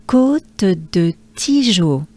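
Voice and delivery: slow, careful Côte de Tijo, each syllable clear; French place name. Shown as a demonstration. Teacher modelling Côte de Tijo for learners, slow and careful. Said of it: "the name sounds like this".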